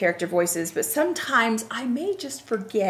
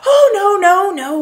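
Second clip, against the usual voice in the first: high, loud mock panicked no-no-no; hammed-up horror, pitched high and blurted loudly; retold alarm; 11 semitones higher, 11 dB louder, slower than usual. Parent remembering an unsuitable scene while watching, mock alarm.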